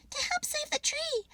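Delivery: high squirrel voice, a touch of puffing. Acted. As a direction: puff it out breathlessly. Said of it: "puffed Pip".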